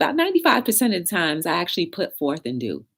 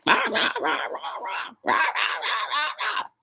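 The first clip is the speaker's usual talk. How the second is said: mock aggressive ranting; 11 semitones higher, 4 dB quieter, slower than usual. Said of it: "somebody came at you like".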